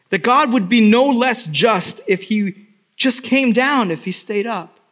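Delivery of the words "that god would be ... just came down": normal talking voice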